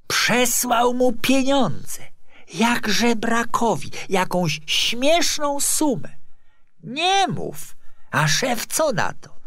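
high, loud, slow mock outrage; scandalised gossiping clerk. Clerk gossiping to a colleague about David, scandalised. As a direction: relish every scandalous word, high and loud.

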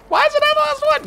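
very high, squeaky whine on the whole line; squeaky mock disbelief. Real, not acted. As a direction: pitch it way up like a cartoon. Repeats the protest, squeakier.

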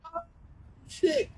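very high squeak; squeaky curse. Real, not acted. Gamer cursing a mistake, squeaky high yelp.

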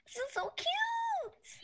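squealing high-pitched and drawn out; excited anime fan. Real, not acted. Squealing over cute build.

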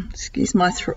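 croaky, whispered after clearing throat; rough, breathless croaky voice; hoarse whisper. Real, not acted. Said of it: "excuse my croaky voice".

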